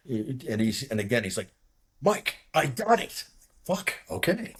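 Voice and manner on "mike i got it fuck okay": high, strained mock exasperation; exasperated. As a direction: imitate a pilot impatiently brushing off a warning. Irritated pilot brushing off warning.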